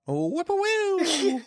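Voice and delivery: high, slow bird call; chirping bird person. Pretend. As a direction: whistle it out like a bird. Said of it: "you hear Dickie say".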